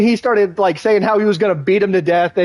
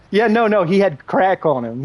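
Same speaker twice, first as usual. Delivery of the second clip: ordinary talk, nothing marked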